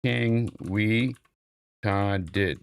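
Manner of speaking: "Sofa King, we Ta did" broken into separate pieces; sounded out. Shown as a demonstration. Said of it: "We can sound it out here".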